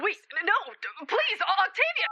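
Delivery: panicked, calling after her as she leaves; desperate plea. Acted. Begging friend not to leave.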